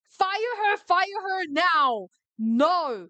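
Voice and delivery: high, outraged order, dragging out the last words; angry shouting. Real, not acted. Reader demanding a blaming employee be fired, shouting angrily. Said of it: "why I'm shouting".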